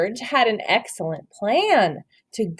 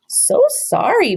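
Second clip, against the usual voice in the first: apologetic; 9 semitones higher, 3 dB louder than usual. Acted. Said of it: "said George with a smile".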